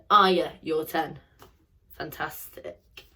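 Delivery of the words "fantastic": soft unvoiced whisper. Shown as a demonstration. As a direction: breathe the praise quietly. Whisper round of phonics game.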